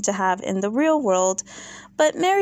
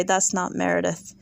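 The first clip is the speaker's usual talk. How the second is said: ordinary talk, nothing marked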